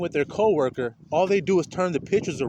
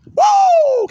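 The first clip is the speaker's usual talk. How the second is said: loud high whoop, much louder; joyful whoop; 14 dB louder than usual. Real, not acted. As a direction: let out a big celebratory holler. Preacher whoops at congregation.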